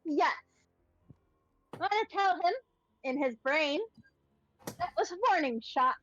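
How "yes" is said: normal talking voice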